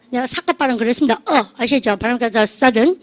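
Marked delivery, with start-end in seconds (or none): none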